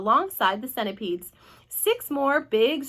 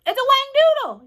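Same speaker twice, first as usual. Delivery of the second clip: very high, loud cry; panicked yell; 9 dB louder than usual. A narrator's reading. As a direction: yell it out in alarm. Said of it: "yelled the head of the fire department".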